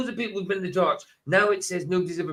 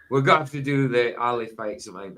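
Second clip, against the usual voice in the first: ordinary delivery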